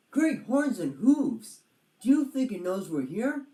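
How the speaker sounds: awestruck by the rhino; amazed. Acted. Roger amazed.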